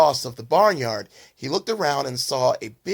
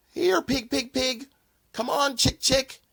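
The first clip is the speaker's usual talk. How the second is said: farmer calling animals; 10 semitones higher than usual. Acted.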